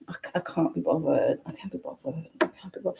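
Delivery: hushed British accent imitation; very soft, mimicked British "can't be bothered"; friend's British accent. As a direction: practise a British friend's catchphrase under your breath. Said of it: "I always emulate her".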